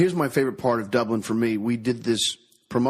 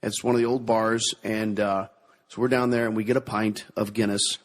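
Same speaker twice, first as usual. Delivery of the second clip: ordinary talk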